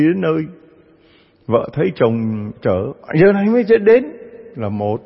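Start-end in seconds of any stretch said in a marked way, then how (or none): 3.2-5.0 scolding wife. Acted. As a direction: scold the late husband sharply. Wife scolds late husband.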